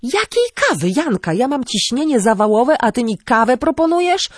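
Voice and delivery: high-pitched, agitated protest; exasperated outburst. A narrator's reading. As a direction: explode in indignant disbelief, pitch soaring. Acted outburst at offer of coffee.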